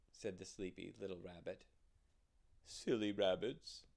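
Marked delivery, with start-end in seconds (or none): 2.7-3.8 put-on louder old owl voice. Old owl dismisses the rabbit, loud owl voice. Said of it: "said the owl".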